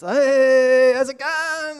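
loud, high-pitched Aussie greeting; exaggerated Aussie. Aussie greets a countryman abroad, broad exuberant Australian accent. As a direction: holler it in broad, hammed-up Australian. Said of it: "fifty percent more Aussie".

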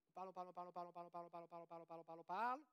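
droning motor-mouth imitation; mock nonstop chattering. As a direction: rattle it off without a breath, like someone who won't stop talking. Mimics people who never stop talking.

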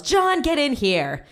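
high, drawn-out mock yell. Dad summoning his son to be told off, mock yell. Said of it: "he yells".

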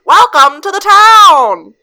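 loud, high, drawn-out hokey welcome; imitated movie town owner. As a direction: boom it out like a hammy local. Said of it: "the owner of the town sounds like this".

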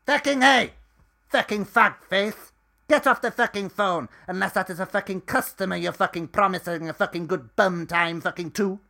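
high, loud shouting at a coworker; furious yell. Acted. Edith yells at Dusty to get off the phone, furious shout. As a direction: bellow him off the phone.

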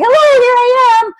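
bright high greeting from a hidden self; sing-song hello. Retold or imitated. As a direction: announce yourself like peeking out. Voicing her hidden self calling out.

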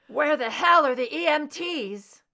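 loud, high desperate yell; hoarse shout. Acted. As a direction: shout for help across the room. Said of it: "His hoarse shout".

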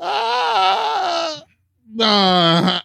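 loud, high wail of disbelief; half-laughing, half-crying outburst; laughing cry of shock. Real, not acted. Reacting to the shocking story.

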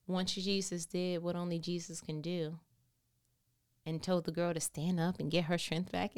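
ordinary talk, nothing marked

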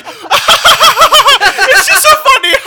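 high squealing laugh-talk, slow and loud; imitating someone cracking up mid-line; laughing hard. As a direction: push the words out through helpless laughter. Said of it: "he begins laughing so hard".

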